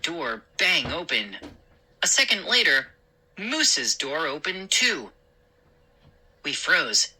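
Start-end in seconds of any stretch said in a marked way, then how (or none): none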